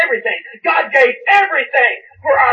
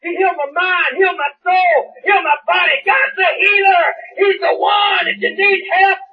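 full-force preaching; 4 semitones higher than usual. Real, not acted. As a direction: push every line out to the church.

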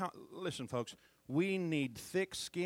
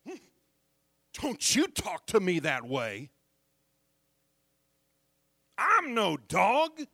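mock indignant; 6 semitones higher, 9 dB louder than usual. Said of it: "gotten all indignant and said".

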